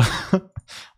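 airy unvoiced chuckle; breathy laugh. Real, not acted. Laughs about refusing German.

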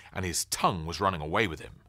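ordinary delivery

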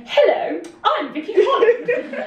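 imitating a cheery hello. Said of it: "going around saying".

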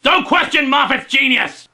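loud, high defensive shout; indignant outburst. Acted. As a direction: cut in yelling, defending your idol. Fan cuts off the critic.

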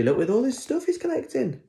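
high, fast and excited. Real, not acted. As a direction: point out the pile with glee. Excited showing pictures to children.

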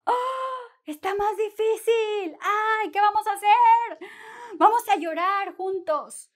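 high, hammed-up wail with gasps; mock despair. Teacher groaning with students over a harder example, mock despair. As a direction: wail in pretend dismay at how hard it is.

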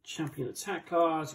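ordinary talk

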